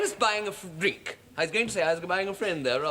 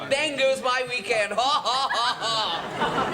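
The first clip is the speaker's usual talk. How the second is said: higher mock of her line, laughing; mimicking posh woman; 6 semitones higher, slower than usual. [name] copying the posh woman's quip, laughing. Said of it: "Oh, she's a laugh".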